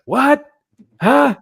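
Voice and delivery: high, loud confused calls; loud high mock confusion, laughing at the end; straining to hear. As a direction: shout back like you can't hear a word. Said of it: "I always feel bad 'cause I'm just like".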